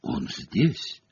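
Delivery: hushed seer's announcement; ominous hush. A narrator's reading. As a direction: breathe it softly, as if it might hear you. Seer's ominous vision in crystal. (тихо, зловещим полушёпотом)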